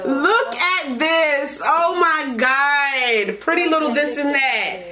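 moved gushing. Real, not acted. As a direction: marvel at the gift slowly. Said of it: "I'm gonna cry".

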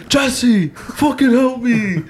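high pleading yell, dragged out slowly; mock yell for help. Gamer friend yelling for help after losing his stuff, mock high yell. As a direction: whine it up high like he's panicking. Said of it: "He's just yelling at me".

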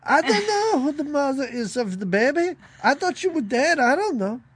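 put-on high, flustered old lady denial; hammed-up frantic denial, pitched high; mock Angela Lansbury. Podcaster imitating Angela Lansbury to Phoebe Cates, flustered and high. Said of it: "Angela Lansbury being like".

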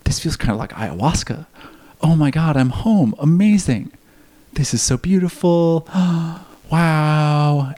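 rising into high, loud awe; retold ecstatic amazement; awed rapture. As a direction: gasp with wonder at coming home. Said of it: "I'm like".

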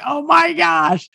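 high, slow exasperated cry; exasperated outburst. Retold or imitated. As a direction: let the frustration burst out. Frustrated with airline refund call.

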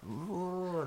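high, drawn-out "ooh" machine noise; imitated menacing beam noise. Friend retelling an anime scene, high imitated beam noise. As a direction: mimic a beam powering up with a rising hum. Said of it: "the noise it makes, that sound".

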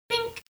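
a sharp high ding, like a bell; ping it out high; high bink sound effect. Retold or imitated. Podcast host mimicking a bell after each jab, high bink.

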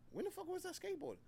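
high, disbelieving question; can't believe it, pitch up; baffled. Retold or imitated. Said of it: "I was like".

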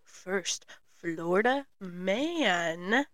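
slow hushed host voice, luring listeners in; podcast intro. Acted. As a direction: lure them in like a storybook witch.

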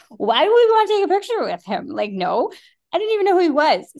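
loud, high, fast bewilderment; can't believe the idea; refusing a photo with a stranger, baffled. Retold or imitated.

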